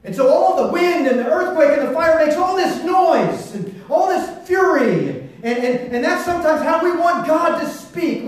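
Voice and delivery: loud, high, driving sermon voice; preaching at full force. Acted. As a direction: preach it out, full power to the back row. Preacher booms about God's power.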